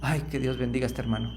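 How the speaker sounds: hushed blessing on the brother. Shown as a demonstration. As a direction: bless him gently and quickly. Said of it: "hay que cambiarlo por".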